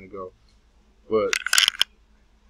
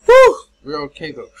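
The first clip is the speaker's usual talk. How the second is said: stubbed toe; 5 semitones higher, 15 dB louder than usual. Real, not acted.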